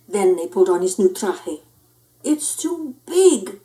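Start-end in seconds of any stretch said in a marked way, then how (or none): 2.2-3.6 slow, whiny complaint; complaining. Acted. Cat complains to the chihuahuas about the big costume. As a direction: drag it out like a grumbling kid. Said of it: "complained the gatito".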